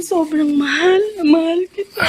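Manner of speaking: high, tearful I love you; tearful declaration. Acted. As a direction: say it through tears. (nangingiyak na pagsabi ng pagmamahal)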